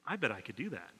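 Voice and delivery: private thought. Retold or imitated. Watching the magic show and thinking aloud, murmured. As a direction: say it under your breath to yourself.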